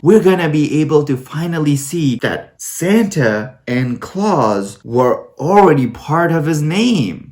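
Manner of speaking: normal talking voice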